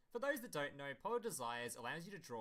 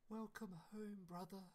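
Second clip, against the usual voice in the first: high, soft little sister voice; 6 semitones higher, 6 dB quieter than usual. Acted. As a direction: coo it like a tiny little sister. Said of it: "In extremely little sister voice".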